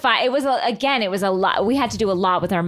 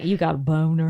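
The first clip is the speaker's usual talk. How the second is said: impression of Chelsea; 6 semitones lower than usual. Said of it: "she's like".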